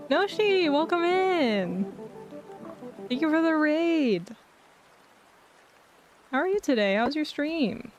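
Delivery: high bright welcoming streamer voice; cute anime streamer. Acted. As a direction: greet the viewers sweetly, up high. Greeting raid viewers in streamer voice.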